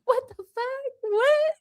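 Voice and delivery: high squeal of laughing shock; high laughing what the fuck; laughing disbelief. Real, not acted. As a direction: crack up in disbelief.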